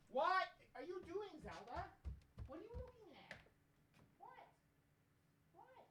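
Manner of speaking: high soft pet voice. Real, not acted. Owner asking barking poodle what's wrong, high soft voice. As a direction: gently quiz the dog about the barking.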